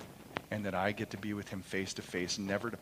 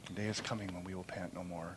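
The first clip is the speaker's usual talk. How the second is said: a hushed echo of the promise; softer, hushed repeat; 3 semitones lower, 8 dB quieter than usual. Shown as a demonstration. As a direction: let it sink in quietly. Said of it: "repeated line".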